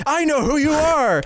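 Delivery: menacing high villain; hammed-up villain line. Imitating villain recognizing RoboCop.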